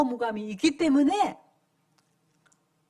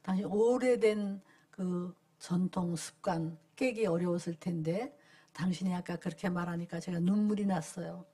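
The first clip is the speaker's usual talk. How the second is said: moved, tender; 7 semitones lower, 7 dB quieter than usual. Retold or imitated. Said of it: "굉장히 감동이에요".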